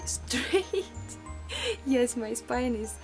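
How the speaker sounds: ordinary delivery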